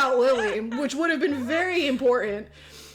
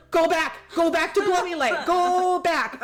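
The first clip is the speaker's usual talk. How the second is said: high, loud command to retreat; mock banishing; 6 semitones higher, 6 dB louder than usual. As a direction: send the scary thing away, hammed up. Acted banishing of scary character.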